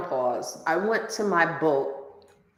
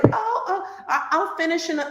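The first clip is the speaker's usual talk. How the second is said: flustered excuse; 8 semitones higher, faster than usual. Retold or imitated. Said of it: "And he's like".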